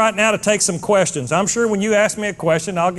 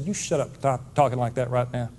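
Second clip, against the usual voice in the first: low, quiet motherly telling-off; understated scolding, soft and low; scolding mama; 6 semitones lower, 7 dB quieter than usual. Retold or imitated. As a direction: lightly imitate a firm mother, voice kept down. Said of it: "She said".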